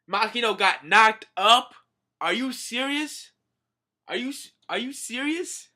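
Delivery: high, loud stunned questions; outraged disbelief at the news. Real, not acted. Shocked at manga reveal.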